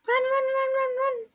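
high frantic running chant; urgent run run run; being chased. Real, not acted.

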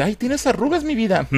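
voz aguda y melosa de esposa; voz de esposa cariñosa. Retold or imitated. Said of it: "le dice". (imitación aguda y melosa)

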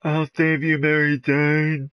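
high, loud, drawn-out cry; hammed-up rescue shout, stretching the name; mock-heroic call.

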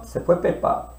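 whispered se fue Pepa; whispered goodbye. Acted. Teacher whispers to kids as card goes. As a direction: hush as the card leaves.

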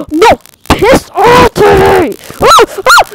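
slow, loud, high shout at the mob; real angry scream at a zombie; furious shout. Real, not acted. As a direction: yell it out in real anger. Attacked by mobs.

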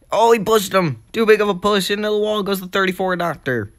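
high-pitched, startled race commentary; excited crash call. Real, not acted. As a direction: react live to the bad push. Live call of a push into wall.

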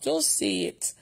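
whispered instruction; barely voiced, very soft; no shouting. Shown as a demonstration. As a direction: whisper it to show shouting is needless. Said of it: "you don't need to shout".

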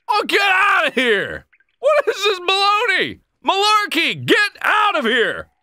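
high indignant yell; outraged shout at the intruder. Real, not acted. Yelling at the intruder.

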